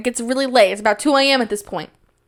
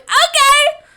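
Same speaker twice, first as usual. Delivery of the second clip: very high, loud squeak; strained agreement; 5 dB louder than usual. Retold or imitated. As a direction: squeal it like forced, panicked consent. Retold forced agreement to pay.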